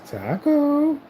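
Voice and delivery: high sing-song call of Taco; calling the cat. Real, not acted.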